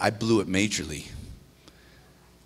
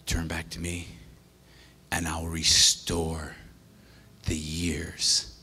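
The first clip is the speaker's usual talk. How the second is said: hushed scripture reading; 7 semitones lower, 6 dB quieter, slower than usual. Acted. Pastor quotes God's promise softly.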